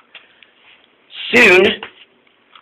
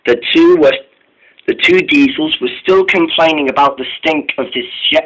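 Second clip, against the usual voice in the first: ordinary delivery, nothing marked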